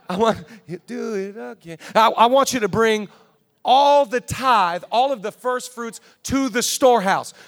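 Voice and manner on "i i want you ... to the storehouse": loud, high, stretched out all the tithe; forceful preaching. Real, not acted. Pastor pressing tithing on the church, loud and high. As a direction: drive it home to the congregation.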